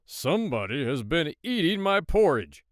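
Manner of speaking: loud, gruff Papa Bear growling his complaint; big gruff bear. A narrator's reading. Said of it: "said in his big, gruff voice".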